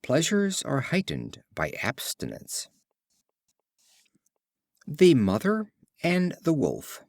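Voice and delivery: ordinary delivery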